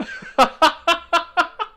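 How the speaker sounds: loud, high real laugh; burst of high laughter. Real, not acted. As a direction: crack up at the twist. Laughing at the punchline.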